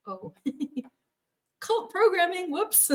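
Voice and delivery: high, giggly oops; laughing at herself. Retold or imitated. Wife laughing at her own cult habit. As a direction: catch yourself with a laugh. Self-mocking realization, laughing.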